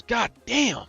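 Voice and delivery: put-on older man slamming his head on the trailer, yelping a curse; pained curse. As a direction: curse on impact, jolted.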